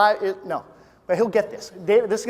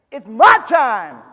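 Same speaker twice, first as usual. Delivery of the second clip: loud, slow crowd answer; shouted reply; 3 semitones higher, 11 dB louder, much slower than usual. Shown as a demonstration. Speaker showing the crowd their answer, loud and slow. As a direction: shout it back with full force. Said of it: "you're gonna be like".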